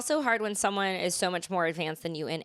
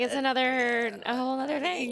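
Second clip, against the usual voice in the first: mock amazed; 6 semitones higher than usual. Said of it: "You're like".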